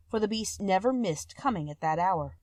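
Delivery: plain reading voice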